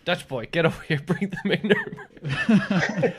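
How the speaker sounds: playful calling out. Pretend. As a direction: yell across the room for the Dutch boy. Calling the mock Dutch boy over.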